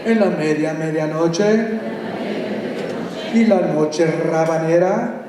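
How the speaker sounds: slow, rhythmic Spanish poem reading; dramatic Spanish verse reading; poem recital. Acted. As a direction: chant the verses like an incantation. Reads a Spanish poem aloud.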